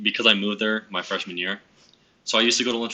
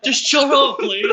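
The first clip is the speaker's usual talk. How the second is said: high, loud mock outburst; exasperated retold yell, pitched up; imitated yell; 8 dB louder, much slower than usual. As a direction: shout it like the interrupting classmate. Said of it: "David yelled".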